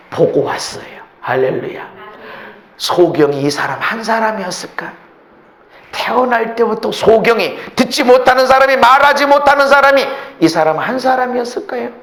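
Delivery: ordinary talk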